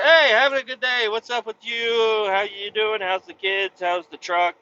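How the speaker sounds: high, loud friendly owner; chatty high owner voice. Retold or imitated. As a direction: play the owner gushing and friendly.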